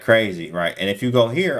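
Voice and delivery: ordinary delivery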